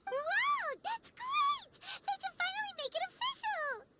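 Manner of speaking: high, thrilled burst of delight; excited. Acted. Paimon to friends about the wedding, thrilled and high. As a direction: gush over the happy news.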